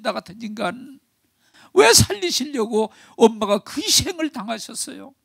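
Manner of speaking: high, pleading sobbing cry; tearful self-blame. Retold or imitated. 딸이 울며 자책함. (높은 목소리로 울며 자책하는 딸)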